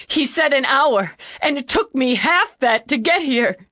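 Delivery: put-on panicked young man crying hard; hiccuping tears. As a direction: plead through loud hiccuping sobs. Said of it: "He burst into tears".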